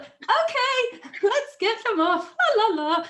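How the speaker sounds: very high, loud, hammed-up singsong; forced cheerful panic. Mum hiding panic from stung child, hammed-up shrieky cheer. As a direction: cover alarm with loud, high mock cheer. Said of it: "I was like".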